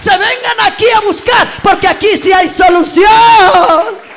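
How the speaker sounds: very loud shout rising high to a climax; rally the congregation, then call them to praise; booming revival exhortation; full-force preaching. Acted.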